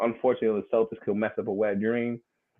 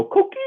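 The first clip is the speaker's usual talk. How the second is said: high piping coo-kee call; coqui frog call; 6 dB louder than usual. Retold or imitated. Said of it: "I'll go".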